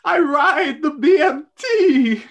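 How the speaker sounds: put-on high, slow, whiny Brooklyn accent. Hildy telling Koopa she's no princess, Brooklyn accent. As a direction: protest in a teary Brooklyn accent. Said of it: "I'm from Brooklyn".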